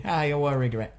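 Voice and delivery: usual speaking voice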